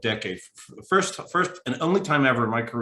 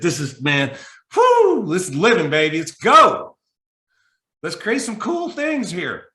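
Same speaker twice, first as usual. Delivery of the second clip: much higher and louder, hyped cheer; pumped up; 6 semitones higher, 6 dB louder than usual. Retold or imitated. Stranded traveler psyching himself up, loud excited cheer. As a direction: shout it like you just got fired up. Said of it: "I got legitimately pumped".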